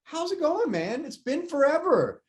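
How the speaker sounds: put-on high, slow, thrilled greeting to an old friend; light up at a long-lost coworker calling; excited. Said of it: "you would get a little bit excited".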